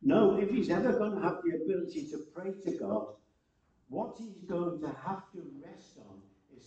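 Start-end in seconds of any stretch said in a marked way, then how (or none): none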